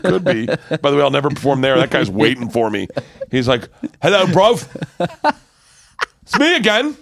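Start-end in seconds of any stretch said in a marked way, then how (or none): 4.0-7.0 put-on high, loud London accent for a doorman; London doorman's accent. Doorman taunts the comedian he is waiting for, mock London accent. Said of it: "that accent is spot on".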